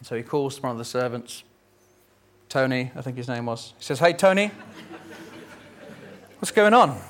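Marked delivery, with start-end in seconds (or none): 4.0-7.0 put-on older brother calling out.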